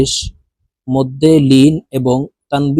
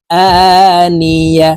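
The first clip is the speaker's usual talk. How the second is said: আনিয়া chanted slowly with the opening আ held long; drawn-out vowel; 4 semitones higher, 4 dB louder, much slower than usual. Shown as a demonstration. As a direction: stretch the first vowel of আনিয়া. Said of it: "এক আলিফ টেনে পড়ব". (আনিয়া-র আ টেনে পড়া)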